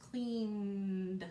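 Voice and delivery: Cleaned drawn out, lingering on the n before the d; teacher stretching the word for the class; stretched out. Shown as a demonstration. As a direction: stretch Cleaned so the N can be heard. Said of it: "before we hear that D sound".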